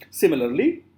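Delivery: ordinary talk, nothing marked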